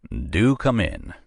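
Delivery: slow, inviting Southern drawl. A narrator's reading. Pendergast invites Nora in, slow inviting Southern voice. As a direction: welcome her in, Southern and slow. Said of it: "in his most inviting southern voice".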